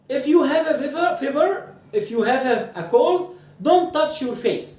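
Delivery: slow, high, stressed don't touch your face; emphatic model sentence. Shown as a demonstration. Teacher models the warning slowly, stressing don't. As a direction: warn them slowly, leaning on don't. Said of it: "ده موضوع الدرس".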